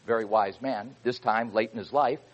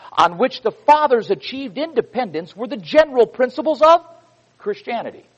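fervent preacher's delivery; preaching a quote; 8 semitones higher, 7 dB louder than usual. Acted. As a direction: proclaim it to the congregation like scripture.

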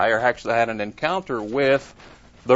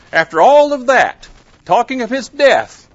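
indignant disbelief; 10 semitones higher, 8 dB louder than usual. Real, not acted. Indignant at disciples' dispute.

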